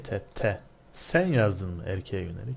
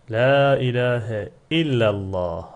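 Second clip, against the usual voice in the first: recitation; 7 dB louder, slower than usual. Shown as a demonstration. Teacher reciting Arabic sentence.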